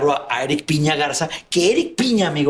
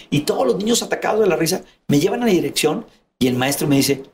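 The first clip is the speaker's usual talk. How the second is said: ordinary talk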